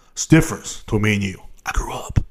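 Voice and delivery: whisper. Acted. Aside jab at brother.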